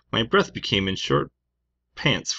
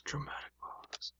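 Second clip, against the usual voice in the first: hushed, slower stage whisper; whispered aside; 6 semitones higher, 21 dB quieter, slower than usual. Pretend.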